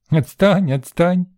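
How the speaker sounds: high-pitched mimicking tease; teasing little girl. A narrator's reading. Girl playfully echoes the boy's word, high and teasing. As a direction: parrot it back like a gleeful child. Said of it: "засмеялась девчонка".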